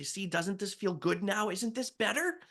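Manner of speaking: high, singsong encouragement; acted cheery caregiver questions; coaxing. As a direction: coax her like a small child, pitched high. Coaxing mother as legs move.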